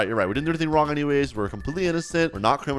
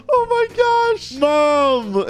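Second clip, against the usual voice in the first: gasp, then much higher, loud cry; gasping joyful surprise; 6 dB louder, much slower than usual. Real, not acted. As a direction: gasp and call out to mom in delight. Sees his recovered mother.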